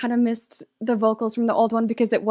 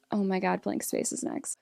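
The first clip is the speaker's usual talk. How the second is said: hushed, much softer, breath-only whisper; nervous whisper; 2 semitones lower, 10 dB quieter than usual. Real, not acted. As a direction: whisper it like a scary secret. Nervous before the big song.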